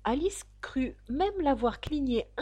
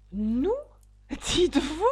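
higher, offended echo; indignant cry; 5 semitones higher, slower than usual. A narrator's reading. The mouse trembles head to tail at Alice, indignant cry. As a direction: throw it back as an affronted question. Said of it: "s'écria la souris en tremblant".

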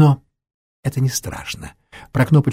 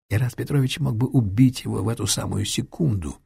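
ordinary delivery, nothing marked

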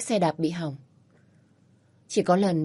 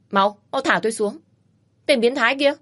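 higher, louder furious yell; angry shout; 5 semitones higher, 5 dB louder than usual. Acted. Woman on his back yells at the pervert, furious. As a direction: scream at him to put her down.

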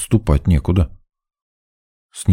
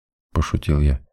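plain reading voice

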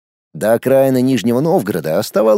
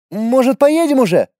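put-on high impatient boy; lightly voiced young boy; 10 semitones higher than usual. A narrator's reading. Thirteen-year-old boy speaks.

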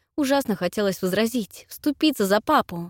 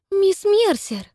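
high, louder gasping protest; shocked gasp; 13 semitones higher, 5 dB louder, much slower than usual. A narrator's reading. Cynthia shocked at Miss Mercer's remark, gasping high. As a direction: cry out the name in shock. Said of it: "ахнула я".

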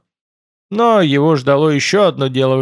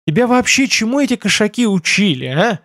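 exasperated mentor, pitched up; higher, disgruntled questioning; displeased; 6 semitones higher than usual. A narrator's reading. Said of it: "Недовольно вздохнул Байда".

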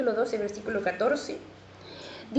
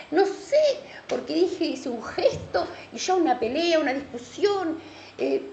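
raised, higher preaching voice; 4 semitones higher, 3 dB louder than usual. Acted. Preacher warning the church about hidden bonds, full preaching voice. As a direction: drive it home to the congregation.

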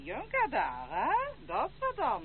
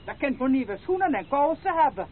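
higher, louder indignation; indignant retort; 6 semitones higher, 4 dB louder than usual. Acted. Grandmother snaps back that she is the boy's family, indignant. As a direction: bristle and defend herself.

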